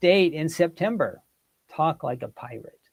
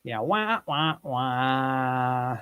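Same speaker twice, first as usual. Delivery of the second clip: mock sad trombone; 2 semitones lower, 3 dB quieter, much slower than usual. Mock sad trombone after question.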